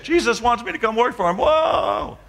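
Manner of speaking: high, elated re-enacted cry; retold elation. Man on the bridge railing realizing Jesus wants him, retold elation. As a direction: exclaim it high, soaring into a long whoa.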